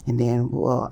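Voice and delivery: usual speaking voice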